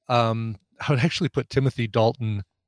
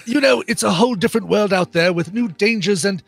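raised, fussy, exasperated quartermaster; John Cleese as Q; 4 semitones higher than usual. Acted. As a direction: primly lecture the careless agent. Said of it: "I'm doing the John Cleese".

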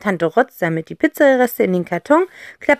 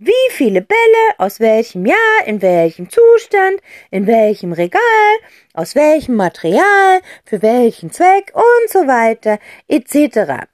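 higher, listing with relish; lively aunt; 7 semitones higher, 5 dB louder than usual. Acted. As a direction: reel off the list like a gleeful aunt. Aunt tells the story. (Tante Rotz zählt vergnügt auf)

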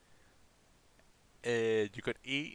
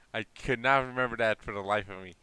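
normal talking voice

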